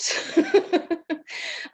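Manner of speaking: a high breathy laugh at herself; burst of laughter. Real, not acted. Laughing at her old self while telling the group. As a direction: laugh it off, embarrassed.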